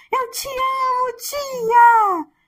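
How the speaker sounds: put-on high, bright child voice calling out to teacher; imitating a small child shouting his love; little child. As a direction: squeal it like a delighted little boy. Child greeting teacher quoted.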